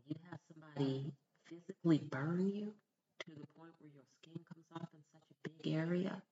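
usual speaking voice

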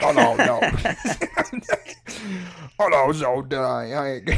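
hammed-up "oh no", cracking up; mock protest voice. Co-host acting out the caught impressionist, laughing mock protest. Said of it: "And you're like".

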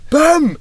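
high shouted pam sound. Retold or imitated. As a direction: yell pam like a slap. Sound effect in story.

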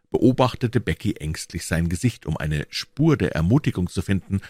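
ordinary delivery, nothing marked

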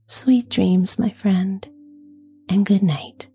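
soft, gentle whisper; soft bedside voice. Real, not acted. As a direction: whisper it gently, like tucking someone in. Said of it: "with a nice, soft, gentle voice".

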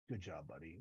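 grudging mutter of approval; stone-faced deadpan. Retold or imitated. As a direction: say it with a stone face, no warmth at all. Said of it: "he doesn't say, "Good job, buddy," but he, like, stone faces it".

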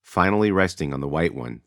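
ordinary talk

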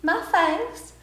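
high, coaxing Southern vampire voice; high-pitched friendly Southern vampire; sweet Southern vampire. Pretend. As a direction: coax the victim with Southern charm. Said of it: "You're like".

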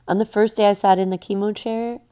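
usual speaking voice

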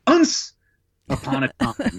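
put-on squeaky high child voice on Once; little kid sounding out a word; child struggling to read. Boy reading aloud to his mom, squeaky and unsure. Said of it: "And I'd go".

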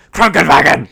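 Krankenwagen barked loud, mock German; loud laughing German demo; German pronunciation. Shown as a demonstration. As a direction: shout Krankenwagen like a parody German. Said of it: "I'll give you a perfect example".

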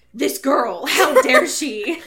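loud, drawn-out indignation with a laugh; mock outrage. Host voices the upset boyfriend, mock-outraged. Acting Edward's offended reaction.

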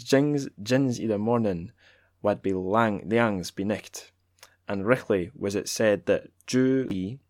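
lightly put-on Scots accent for the poem. Reading a Scots translation aloud, in a Scots accent. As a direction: roll it out in a Scots brogue. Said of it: "shall I keep doing the accent?".

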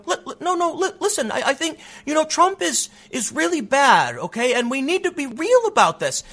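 high-pitched, stammering caricature of earnest politicians; mock Democrats on stage. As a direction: imitate a flustered candidate pleading to be heard. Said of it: "are up on stage going".

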